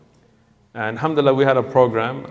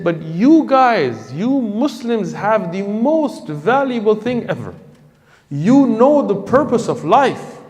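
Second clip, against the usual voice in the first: higher and slower, pleading and accusing; impassioned plea; 9 semitones higher, 3 dB louder, slower than usual. Retold or imitated. The convert pleading with Muslims who keep faith to themselves, impassioned. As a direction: make each phrase land like a heartfelt accusation. Convert's emotional rebuke retold.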